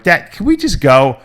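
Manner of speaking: put-on a kid begging to leave now; impatient kid. Kid pleading with a slow-packing dad, impatient whine.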